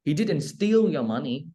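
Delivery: steal lifted, slow with rising end; stress on STEAL. Shown as a demonstration. Teacher to student, stressing steal. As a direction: lean on steal to suggest borrowing. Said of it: "nhấn mạnh vào cái chữ steal này".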